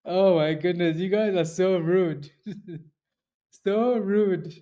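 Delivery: high, slow protest through laughter; tickled and pretending to be hurt; drawn-out, amused indignation; giggly mock offence. Real, not acted. Protesting teasing between laughs.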